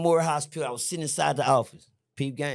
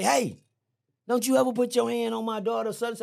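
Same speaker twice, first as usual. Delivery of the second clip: higher pitched scolding warning; mother's warning; 5 semitones higher than usual. Retold or imitated. Mother scolding the boy about her daughter, higher. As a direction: wag a finger at him with your voice. Angry mother warns him.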